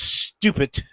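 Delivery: slow "stupid" with a long hissed start; stretched sibilant on "stupid"; extended S. Shown as a demonstration. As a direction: hold the S before the rest of "stupid". Learner practising the extended S.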